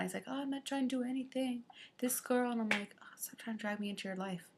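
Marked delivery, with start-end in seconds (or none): 0.3-2.5 imitated grumble, very quiet; muttered excuse. Acting out the driver's excuse. 3.0-4.5 very quiet, quick acted aside; muttered retort. Teller's muttered retort to driver.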